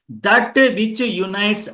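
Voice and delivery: slow, word by word for note takers; slow dictation. Shown as a demonstration. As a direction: spell it out slowly so they can write it. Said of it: "please underline those who are writing the notes".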